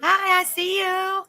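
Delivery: slow, high, stretched greeting; light up and stretch out the hi; drawn-out excited hi. Real, not acted. Host greeting her guest as she appears on stream, slow and high.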